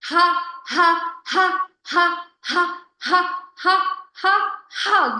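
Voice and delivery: loud, high ha ha pulses; counted laughter. Shown as a demonstration. Teacher leading five counted laughs, loud and high. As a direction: belt five laughs on one breath. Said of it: "Seven times".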